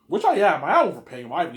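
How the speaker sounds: forceful loud confession; loud admission. Real, not acted. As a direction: own it loudly. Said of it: "self admission loud".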